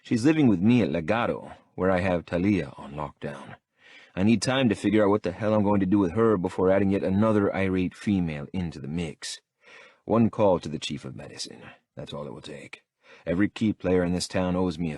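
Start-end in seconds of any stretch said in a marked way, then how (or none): none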